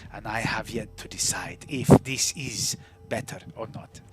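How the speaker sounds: put-on soft, slow-paced foreign-accented character; hushed, accented storyteller of their own past; measured game character. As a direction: speak softly and deliberately, in no hurry.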